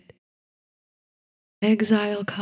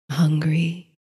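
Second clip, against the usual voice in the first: hint of reptilian growl. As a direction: let a faint reptile growl under the word. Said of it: "with the merest suggestion of reptilian growl".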